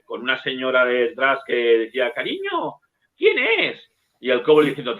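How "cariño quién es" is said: much higher, a mock housewife calling; high wife's voice. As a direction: squeak it like a wife asking who's at the door. Wife calls from behind door. (voz aguda de señora imitada)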